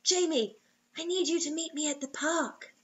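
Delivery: soft pleading hush into the phone; barely there, almost whispered. Acted. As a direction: friend begging him to come, hushed. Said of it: "hardly than a whisper".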